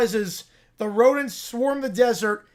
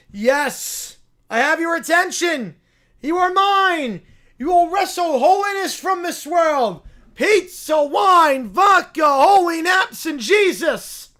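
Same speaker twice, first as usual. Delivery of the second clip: high, loud zealot sermon to the rats; rally the rodents like a mad prophet; preaching bear; 7 semitones higher, 7 dB louder, slower than usual. Acted.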